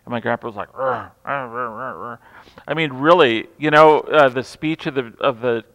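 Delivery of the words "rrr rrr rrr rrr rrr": low, much softer wordless grumbling growl; grumpy grumble. Retold or imitated. Grandpa grumbling when handed the baby, low growl. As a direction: growl under your breath like an old grouch. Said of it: "He grumbled".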